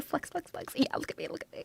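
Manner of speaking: very soft, nearly voiceless boast; tiny whispered show-off; hushed mock bragging. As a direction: whisper a silly little brag to yourself. Bragging mock quote, trails tiny.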